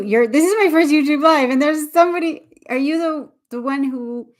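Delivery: high, loud, breaking off mid-thought; excited, flustered. Real, not acted. Host greeting a first-ever live guest, excited and flustered.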